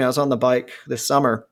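ordinary talk, nothing marked

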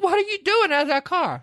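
high, squealing alarm; shocked. Retold or imitated. Retelling the young women watching their car get rammed, high panic.

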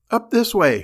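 high, loud panicked cry; shrill frantic shout upward. Acted. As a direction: call out in sudden terror. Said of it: "she cried senselessly".

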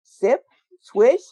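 normal talking voice

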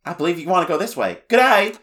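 mock Australian, high and quick, ending in a loud G'day; hammed-up Aussie hamster voice; high fast Australian impression with a shouted G'day; Australian accent. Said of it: "He's Australian for some reason".

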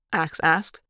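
ordinary delivery, nothing marked

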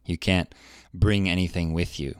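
ordinary talk, nothing marked

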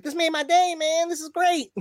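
high, delighted squeaky voice; very high-pitched happy impression. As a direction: impersonate a thrilled rapper way up high. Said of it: "have that, like, high voice".

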